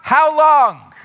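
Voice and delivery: loud, high anguished plea; psalmist's lament cry. Acted. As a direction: cry it up to heaven. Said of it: "he's crying out".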